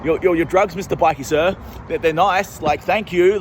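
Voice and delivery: high, nervous grovelling to the bikie; mock nervous appeasing. Held at gunpoint, thanking the bikie in mock fear. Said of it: "I'm like".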